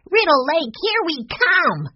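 put-on very high cheering kid voice; excited cry. A narrator's reading. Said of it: "cried Benny".

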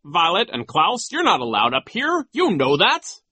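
high, loud scolding official; pompous, high-voiced reprimand; stern. A narrator's reading. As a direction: tell the children off, pitch climbing. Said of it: "he said sternly".

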